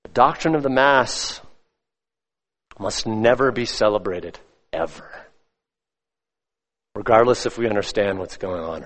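low, softened, grave pronouncement; solemn preaching. Real, not acted. Preacher condemns the Mass to his congregation, solemn and hushed. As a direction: weigh each phrase gravely, with long pauses.

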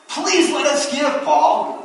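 poor believers begging Paul, high and pleading. Retold or imitated. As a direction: plead earnestly to be allowed to give. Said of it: "begging Paul to give".

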